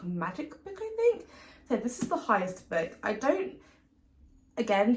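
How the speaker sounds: usual speaking voice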